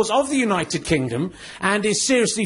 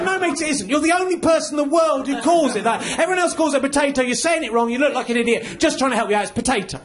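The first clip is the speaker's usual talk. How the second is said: higher-pitched, exasperated putting-right; exasperated correction; 3 semitones higher than usual. Acted. Comic tells his potato-saying friend he's wrong, exasperated. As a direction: correct them in a raised, insistent pitch.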